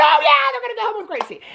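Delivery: hammed-up delight. Viewer cheering the boy's choice of Gracie, overjoyed squeal. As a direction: squeal it like a thrilled fan.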